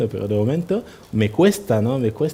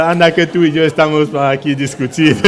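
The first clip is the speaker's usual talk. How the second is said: laughing retort; 3 semitones higher, 7 dB louder than usual. Retold or imitated. His laughing retort to the grocer.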